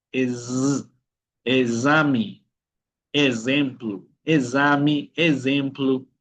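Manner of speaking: "exame, ejemplo" as "ezami, ezemplu"; bee sound Z. Shown as a demonstration. Teacher modelling the bee sound, "exame, ejemplo" with buzzed Z. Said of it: "el sonido de la abeja".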